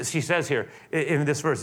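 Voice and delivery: ordinary talk, nothing marked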